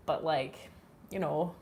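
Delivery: ordinary talk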